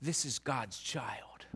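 slow hushed whisper; whispered secret. Retold or imitated. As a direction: breathe the secret slowly. Mary reveals secret.

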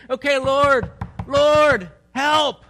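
loud, high cry for help. Acted. Believer knocking at heaven's door, crying out loud. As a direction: call out to the Lord at the door.

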